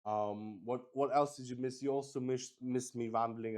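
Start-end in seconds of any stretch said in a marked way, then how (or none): none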